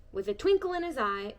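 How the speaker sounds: plain reading voice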